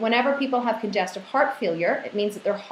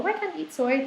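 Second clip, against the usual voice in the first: pitched-up quizzing question; probing question; 8 semitones higher than usual. Retold or imitated. To people who avoid soy, a pitched-up quizzing question. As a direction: press them on why. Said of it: "whenever I dig a little deeper".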